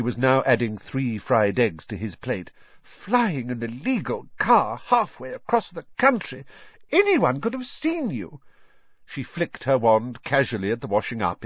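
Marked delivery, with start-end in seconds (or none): 3.0-8.4 high, scandalised motherly reproach; aghast scolding. A narrator's reading. Mother scolding her sons over flying the car, aghast. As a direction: scold with rising alarm, pitch leaping up.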